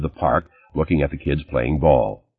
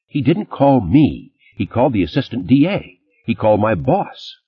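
indignant; 6 semitones higher than usual. Acted. Lauren defensive, heated.

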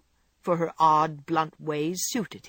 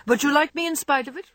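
higher, faster, louder coaxing question; teasing old man; 8 semitones higher, 4 dB louder, faster than usual. A narrator's reading. Old gentleman quickly presses Jo, lightly gruff. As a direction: push her for the answer, amused.

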